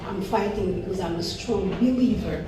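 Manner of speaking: normal talking voice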